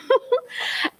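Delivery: short, high chuckle; amused little laugh at your own line; quick giggle; light laugh. Real, not acted.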